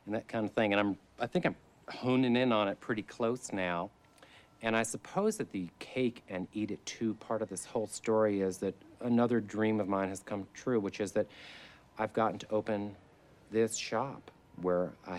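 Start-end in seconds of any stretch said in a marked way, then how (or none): none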